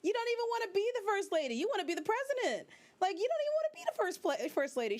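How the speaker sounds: mock outrage at Olivia; sassy rant. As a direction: tell her off like she can hear you. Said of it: "I'm like".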